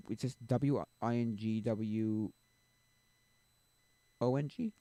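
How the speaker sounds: each letter of W I N G W O N G spelled slowly. Shown as a demonstration. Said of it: "'Cause I have a".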